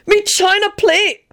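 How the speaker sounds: very high, drawn-out comic voice; choked out. A narrator's reading. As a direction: squeeze it out, overcome with mirth. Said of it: "Benji choked out".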